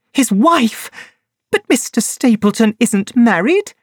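high, shocked woman's voice; high startled woman hearing her lover is married; Laura Lyons shocked. A narrator's reading. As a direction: gasp it out in disbelief.

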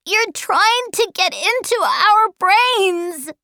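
put-on high-pitched child's protest; accusing boy. Charles Wallace resisting the mind-controlling voice, high accusing boy. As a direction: accuse him in a high boy's voice.

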